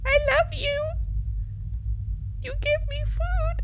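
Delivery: high, slow sweet talk to fridges; cutesy baby voice. Pretend. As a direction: coax the fridges like pets.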